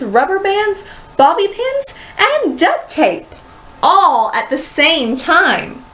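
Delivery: ordinary delivery, nothing marked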